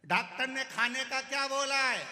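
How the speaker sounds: high, loud question; shouted question. Acted. Deaf visitor yells diet question to patient, loud. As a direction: bellow it at the patient. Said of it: "deaf visitor asks loudly".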